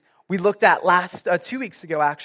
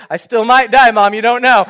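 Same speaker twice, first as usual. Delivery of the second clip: a bit louder, teasing and joking; joking lightly; 4 semitones higher, 9 dB louder than usual. Retold or imitated. To the crying mom on the way to hospital, loud joking. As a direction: keep it light while in danger.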